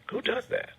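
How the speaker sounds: a soft unvoiced whisper of disbelief; whispered repeat. Real, not acted. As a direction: breathe it out quietly, stunned. Repeats question under his breath.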